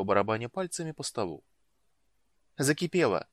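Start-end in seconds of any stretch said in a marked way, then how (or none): 2.6-3.3 high, louder exclamation; excited cry. A narrator's reading. Impatient woman watching the pot boil, crying out. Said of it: "крикнула она".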